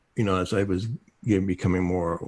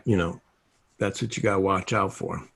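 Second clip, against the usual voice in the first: normal talking voice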